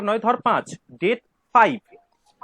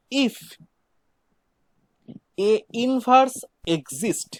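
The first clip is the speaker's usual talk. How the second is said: very slow, one word at a time; slow dictation; much slower than usual. Real, not acted. Teacher dictating a definition for students to write, slow dictation. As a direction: dictate with long gaps for writing.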